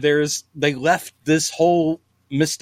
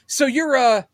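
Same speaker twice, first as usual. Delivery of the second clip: mock TV actor line; 7 semitones higher than usual. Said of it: "and he goes".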